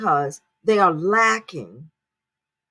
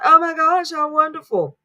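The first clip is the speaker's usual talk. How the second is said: high, louder sarcastic gasp of delight; mock awe; 7 semitones higher, 4 dB louder than usual. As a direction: fake amazement, dripping with irony. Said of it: "And I thought".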